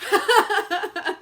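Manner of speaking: high loud burst of laughter. Real, not acted. Laughing at being tricked.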